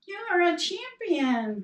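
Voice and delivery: the echo replying. Retold or imitated. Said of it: "came back".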